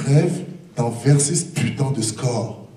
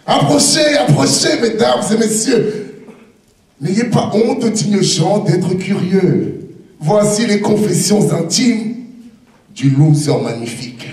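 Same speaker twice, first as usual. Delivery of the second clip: singsong market cry; 4 semitones higher than usual. Acted. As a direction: call the crowd like a showman. Fairground barker call.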